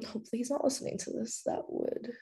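quick, hushed aside. Real, not acted. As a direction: drop to a quick hush. Joking aside about a listening man.

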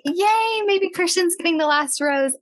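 high-pitched mock-excited yay; excited little celebration, retold; retold hopeful cheer. Retelling her own hopeful cheer.

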